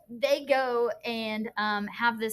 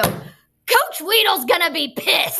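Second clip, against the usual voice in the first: loud, slow, furious student outburst; angry student; 5 semitones higher, 10 dB louder, much slower than usual. Retold or imitated. Teacher imitates student slamming the table in anger. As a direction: bang it out like a mad kid. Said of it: "slam his hands down on the table".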